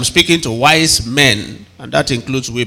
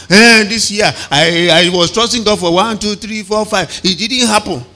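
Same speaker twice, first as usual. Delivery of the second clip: mock complaint; 6 semitones higher than usual. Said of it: "some people will sit down now".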